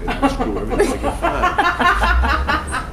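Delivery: loud, high laughter through words; laughing hard while telling it. Real, not acted. Funny memory of friends.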